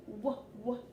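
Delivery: "w" said as a short "wuh", twice; letter sound demo. Shown as a demonstration. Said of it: "w sound".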